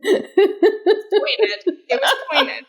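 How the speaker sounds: laughing through the words, pitch up high. Real, not acted. Laughing as she defends her pointed wording. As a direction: giggle it out, half amused at herself.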